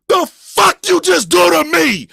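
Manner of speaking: high, loud angry yell; retold outraged shout. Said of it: "never said the N-word louder".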